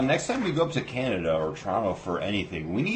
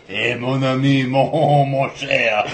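Gambit voice; 6 dB louder, much slower than usual. Retold or imitated. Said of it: "bad voice for Gambit".

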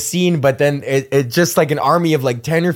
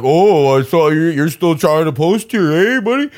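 sneering troll; 3 dB louder than usual. Retold or imitated. Downvote nerds taunting him for posting again, sneering mock voice. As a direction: lightly sneer it like a smug internet troll. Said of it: "just be like".

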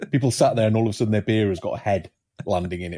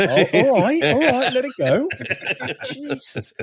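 much higher, laughing, mock-offended; laughing protest; 3 dB louder, slower than usual. Real, not acted. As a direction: laugh it off in outrage.

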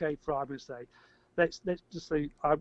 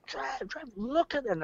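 high mock yell at a mate; retold scream; 10 semitones higher, slower than usual. Man calling mate Trev to see objects, high mock yell. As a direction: call your friend to look up, pitched high. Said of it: "I'm screaming at Trev".

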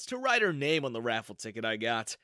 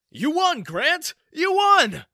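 high, loud joyful shouting; excited cheering; 10 dB louder, slower than usual. Acted. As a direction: cheer it out, much higher. Coworkers cheering the winner.

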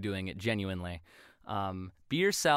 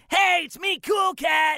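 high, loud cartoon cat shout; Cool Cat impression; 7 dB louder, slower than usual. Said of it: "It reminded me of Cool Cat".

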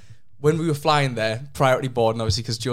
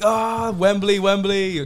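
cheery boat guide; 7 semitones higher, much slower than usual. Retold or imitated. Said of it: "he came over and he was like".

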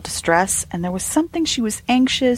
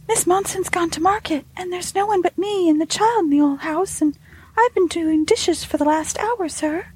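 put-on high-pitched young maid's voice; high, earnest servant explaining herself; servant girl; 11 semitones higher than usual. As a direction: play a deferential housemaid, pitched well up.

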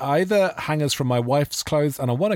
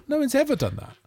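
ordinary talk

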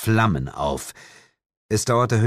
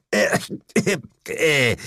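higher-pitched spluttering cough; choking cough; 7 semitones higher, much slower than usual. A narrator's reading. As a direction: choke and cough, caught out. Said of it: "verschluckte sich und fing an zu husten". (verschluckt husten)

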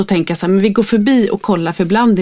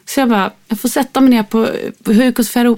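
ordinary delivery

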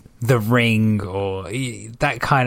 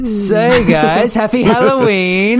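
excited greeting; 8 semitones higher, 4 dB louder, slower than usual. Real, not acted. Halloween greeting.

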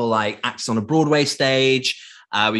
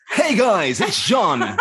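high, loud hype greeting; fitness instructor voice; 7 semitones higher, 3 dB louder, much slower than usual. Retold or imitated. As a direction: pump up the class. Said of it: "instructors' voices change from like".

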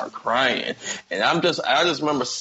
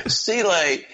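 ordinary delivery

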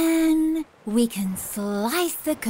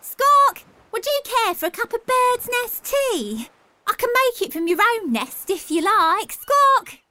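high, loud bright-voiced bird with squawks; a friendly newcomer asking to share; cheery seagull; 9 semitones higher, 4 dB louder than usual. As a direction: Helen Beak greeting the seagull, high and loud. Helen offers tea.

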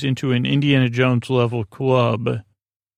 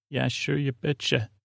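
quiet mock-regional Syracuse accent; 7 dB quieter than usual. Shown as a demonstration. Host trying out hometown talk, soft Syracuse accent. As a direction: toss it off softly in a put-on Syracuse accent. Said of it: "what would I say in Syracuse?".

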